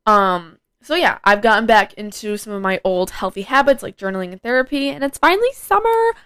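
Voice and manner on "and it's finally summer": squealing, much higher; excited squeal. Real, not acted. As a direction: gush about summer. Excited about summer.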